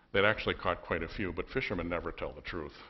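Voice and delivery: ordinary delivery, nothing marked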